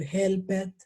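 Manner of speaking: "helped" with the -ed as a full extra syllable, hel-pet; wrong pronunciation. Shown as a demonstration. Teacher showing students what not to say, mispronounced. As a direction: sound out the silent e in "helped". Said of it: "Yo no puedo decir".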